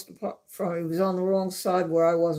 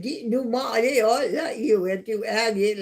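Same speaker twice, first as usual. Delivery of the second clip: a mock Australian mumble, higher and louder; lightly hammed-up Australian accent, higher pitch; [name]'s Australian accent; 6 semitones higher, 4 dB louder than usual. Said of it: "like that accent".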